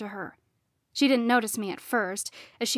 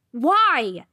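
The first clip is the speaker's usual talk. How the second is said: loud, higher, drawn demand; angry raised-voice question; yelled; 4 semitones higher, 8 dB louder than usual. A narrator's reading. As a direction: yell for an answer. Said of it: "I yelled".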